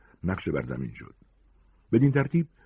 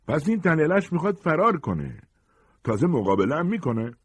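high, raised-voice jeer; shouting; 10 semitones higher, 3 dB louder than usual. Acted. Gang leader shouting about his cornered prey, high mocking shout. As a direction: call it out high and scornful. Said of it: "فریاد زد".

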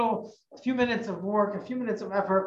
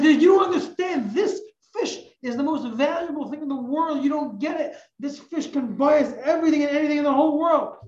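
pleading insistence; 6 semitones higher than usual. Acted. Man insists fish valuable.

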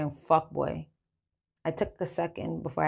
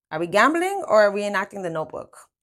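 higher, louder playful jab; teasing; 4 semitones higher, 7 dB louder than usual. A narrator's reading. French ribs the lovebirds at the table, teasing. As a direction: rib the couple with exaggerated impatience. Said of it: "French tease".

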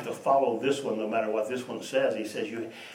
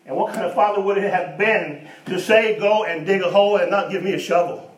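loud, higher rising preacher's challenge; preaching at full force; 7 semitones higher, 7 dB louder than usual. Acted. Preacher to congregation about fathers, loud and higher. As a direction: drive the question home, louder and higher.

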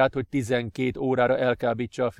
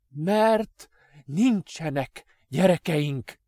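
high, loud, very slow cry; shouting; 5 semitones higher, 4 dB louder, much slower than usual. Acted. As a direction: shout it out after coughing. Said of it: "kiáltotta".

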